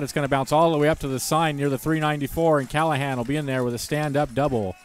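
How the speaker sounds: loud, high, rising home-run call; excited play-by-play. Real, not acted. Big hit play-by-play.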